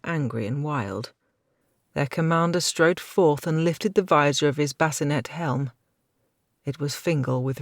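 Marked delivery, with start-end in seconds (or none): none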